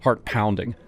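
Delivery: ordinary delivery, nothing marked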